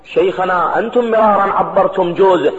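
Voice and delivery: normal talking voice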